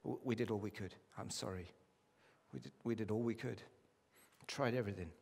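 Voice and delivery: soft, regretful bad news to the family; quiet apology. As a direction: break the news gently, barely above a murmur. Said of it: "And you literally say".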